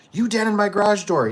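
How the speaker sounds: high accusing mock complaint; imagined Karen. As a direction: ham up a picky customer's gripe.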